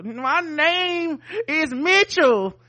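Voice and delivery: high, very slow defiant line; defiant mock reply. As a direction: drag it out defiantly.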